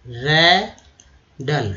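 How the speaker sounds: रैडल stretched very slowly as "re-dal"; drawn-out pronunciation. Shown as a demonstration. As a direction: drag out रैडल syllable by syllable. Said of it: "spelling रैडल का लिखा जाता है". (रैडल को बहुत धीरे खींचकर बोलें)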